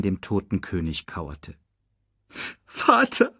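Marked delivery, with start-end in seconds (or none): 2.7-3.3 whimper. A narrator's reading. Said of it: "wimmerte er".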